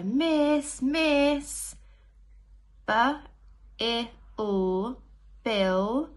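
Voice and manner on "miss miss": high, loud calling out to miss; child calling teacher. Acted. Teacher reading to class, voicing a child calling miss. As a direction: call up to the teacher like an eager pupil. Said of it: "So they say".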